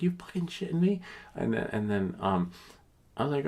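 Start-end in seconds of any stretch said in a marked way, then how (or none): 0.0-1.0 high-pitched incredulous squeak; mock disbelief. Telling viewers his reaction to the clerk, high mock outrage. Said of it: "I'm like".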